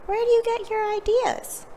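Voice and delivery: put-on high, childlike questioning voice; children crowd round Emma, curious; children's voices. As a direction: pitch way up like curious kids. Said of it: "the children asked".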